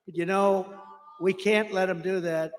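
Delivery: ordinary talk, nothing marked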